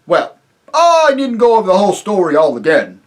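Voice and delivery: high, loud, hearty rustic voice with a chuckle; jovial rustic chief, laughing as he talks; chief of the invisible folk. Acted. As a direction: boom it out like a jolly simple village chief. Chief voice speaks.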